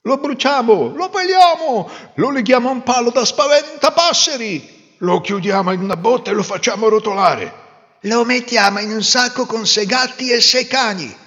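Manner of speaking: high, loud angry crowd yelling; angry mob shouting; storm of shouts. A narrator's reading. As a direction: yell the villagers' punishments over each other. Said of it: "scoppiò una tempesta di grida".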